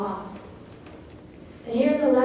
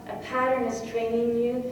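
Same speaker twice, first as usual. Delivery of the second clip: ordinary talk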